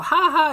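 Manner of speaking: a high, loud, eerie little "ha ha"; a sinister children's-host giggle; creepy high laugh. Retold or imitated. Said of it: "He'd be all".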